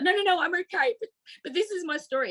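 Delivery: high, teary no no no, I'm okay; retold through tears, pitch up; tearful reassurance. Calling a charity in tears, pleading tearfully. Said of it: "and I was crying".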